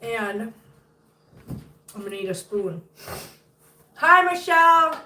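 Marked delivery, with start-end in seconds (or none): 4.0-5.0 high, loud, drawn-out hello; loud stretched greeting to a friend joining the live; excited greeting. Real, not acted. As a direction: sing out a big slow hi. Greeting Michelle loudly, drawn out.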